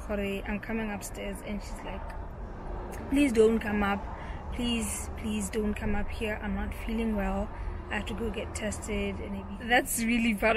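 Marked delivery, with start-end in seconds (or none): none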